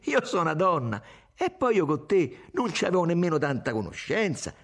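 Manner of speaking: high-pitched, impassioned self-defence; vibrant retort. A narrator's reading. Irene defends talking to men, voice vibrant. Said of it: "disse vibrante Irene".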